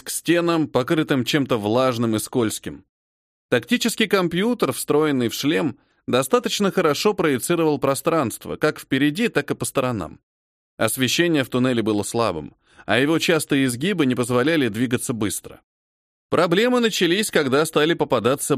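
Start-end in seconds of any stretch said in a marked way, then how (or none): none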